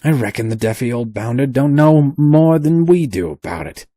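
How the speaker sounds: loud, high rough sailor sneer; rat-faced sailor growl. A narrator's reading. Rat-faced sailor sneering at the professor. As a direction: growl it with contempt. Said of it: "growled the rat-faced sailor".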